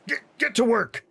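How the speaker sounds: high-pitched, irritated command; gruff dwarf order. Acted. Cursing dwarf orders the crew to work, irritated.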